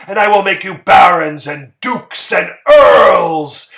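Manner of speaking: loud, ringing promise; fervent cry. A narrator's reading. Harrison promising titles to the musicians, crying out loud. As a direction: cry it out like a king granting titles.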